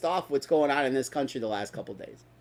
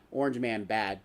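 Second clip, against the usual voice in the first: mocking catchphrase; 9 semitones lower, 10 dB quieter than usual. Mocking catchphrase, low and quiet.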